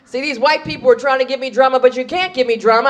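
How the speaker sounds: ordinary talk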